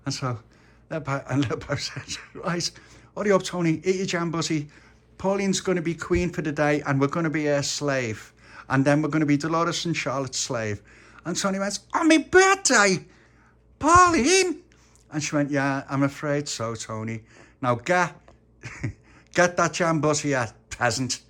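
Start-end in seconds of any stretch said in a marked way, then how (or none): 2.3-10.8 put-on raised, insistent kid voice; bossy child ringleader. Little Pappy bosses Tony about. 11.9-14.6 put-on very high, loud, drawn-out child protest; outraged disbelief. Tony is outraged. 15.5-21.2 put-on high-handed child, breaking into a chuckle; bossy mock queen. Pauline plays the bossy queen.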